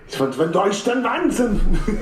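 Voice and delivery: high, exaggerated German accent. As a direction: say it like a hammy German. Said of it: "He pulled off that German accent way too well".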